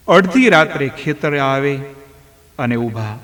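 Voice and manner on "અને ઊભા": usual speaking voice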